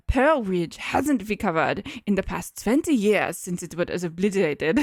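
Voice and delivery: thick put-on German accent. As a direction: garble it as a German doctor. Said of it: "hard to even understand what I'm saying".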